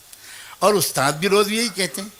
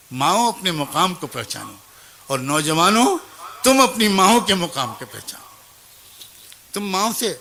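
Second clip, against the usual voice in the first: raised preaching address to the crowd; preaching exhortation. Acted. Preacher urging mothers and young men, raised exhortation. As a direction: rouse the congregation, lift the voice.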